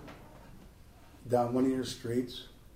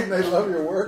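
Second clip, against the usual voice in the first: usual speaking voice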